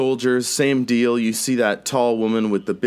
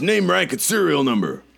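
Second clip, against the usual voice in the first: higher-pitched military demand; put-on soldier's interrogation, pitched up; beefy officer; 8 semitones higher than usual. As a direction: question a stranger as an officer. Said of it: "And he says".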